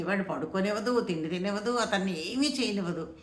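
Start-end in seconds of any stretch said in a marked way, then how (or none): none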